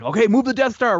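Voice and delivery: high, loud officer giving orders; hammed-up Imperial command; mock Imperial officer. Imperials voiced in a retold scene.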